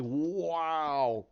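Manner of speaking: long drawn-out exclamation of awe; amazed wow. Acted. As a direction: stretch it out, amazed. Imitates an amazed onlooker.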